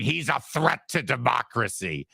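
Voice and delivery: mock shrill alarm; put-on shrill accusation. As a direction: imitate a shrill alarmist pundit. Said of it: "this woman in her shrill voice saying".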